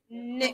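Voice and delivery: a held nasal n; ن said alone as a held n, tongue against the palate; letter sound n. Shown as a demonstration. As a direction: model the n sound for the class. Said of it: "بگوین".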